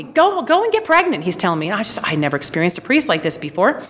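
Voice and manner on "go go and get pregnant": high, loud scolding order; raised-voice mock yell; retold yelling. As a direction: imitate a priest telling someone off. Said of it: "he's yelling at me practically".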